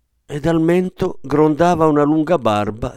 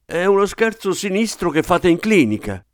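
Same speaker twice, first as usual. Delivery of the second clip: high-pitched outraged accusation; terror turned to anger; 8 semitones higher than usual. A narrator's reading. Father accusing the clinic of a joke, angry. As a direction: accuse her in frightened fury. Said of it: "il terrore tramutato in ira".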